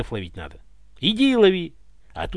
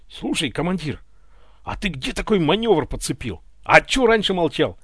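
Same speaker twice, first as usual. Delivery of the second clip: somewhat higher, louder eager praise; sincere admiration; 4 semitones higher, 5 dB louder than usual. A narrator's reading. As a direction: gush over the commander's trick. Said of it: "искренне восхищался Семёнов".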